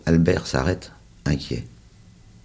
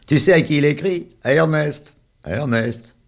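put-on high, loud kid's taunt; sing-song the name to tease; mocking child voice; 11 semitones higher, 6 dB louder than usual. A narrator's reading. Charles teasing the little one about his letter, high and loud.

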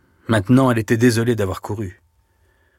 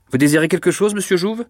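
higher, louder call, rising at the end; 8 semitones higher, 4 dB louder than usual. A narrator's reading. Director calling to the inspector outside, raised voice. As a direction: call out a question to someone at the door. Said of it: "cria Mouret".

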